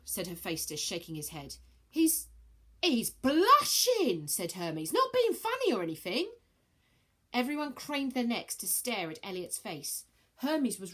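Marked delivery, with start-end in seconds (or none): 1.9-6.3 higher, louder cheeky youth. Acted. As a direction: tease like a cheeky lad. Hermes voiced, high.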